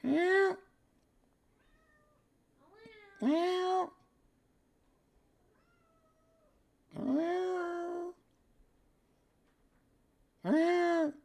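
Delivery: high squeaky meowing; cat meow. Pretend. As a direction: meow back at the cat like a kitten.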